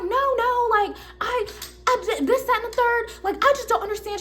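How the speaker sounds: imitating the customer tattling about the clerk; mock whiny customer. Customer to coworker, high mocking whine.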